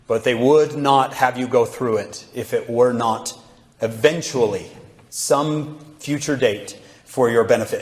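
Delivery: ordinary talk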